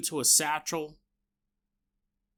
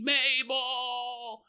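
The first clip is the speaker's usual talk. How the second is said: calling out; 9 semitones higher, 3 dB quieter, much slower than usual. Retold or imitated. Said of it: "he's going".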